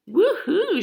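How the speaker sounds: a high, loud whoop; joyful whoop. A narrator's reading. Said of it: "she whooped".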